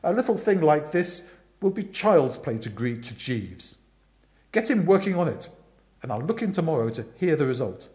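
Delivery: loud, forceful Aunt Dahlia voice; bossy aunt telling Bertie the gossip, loud and brisk; booming aunt. Acted.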